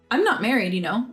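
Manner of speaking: plain reading voice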